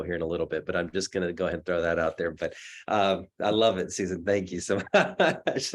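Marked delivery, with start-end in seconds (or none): 4.2-5.7 laughing. Real, not acted. Thanking Susan, laughing mid-word.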